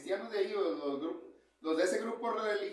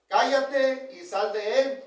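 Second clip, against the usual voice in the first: slow, louder command; rebuke; 3 semitones higher, 6 dB louder, slower than usual. Acted. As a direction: rebuke the spirit firmly and slowly. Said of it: "Jesús le reprendió diciendo". (reprensión lenta y firme)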